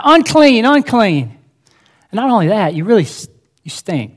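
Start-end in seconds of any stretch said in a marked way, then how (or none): 0.0-1.4 high, loud warning call; crying out. Retold or imitated. Preacher voicing a leper's warning cry to passersby. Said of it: "you had to s-, cry".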